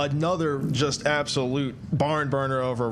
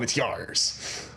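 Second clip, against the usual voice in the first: pirate voice; 6 semitones lower, 4 dB quieter than usual. Pretend. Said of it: "say it like a pirate".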